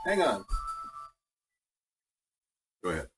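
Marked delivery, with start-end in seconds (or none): none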